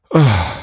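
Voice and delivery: loud, exhausted sigh; weary sigh. Acted. Santa sinks into his armchair after Christmas, weary sigh. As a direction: let out a big worn-out "ah".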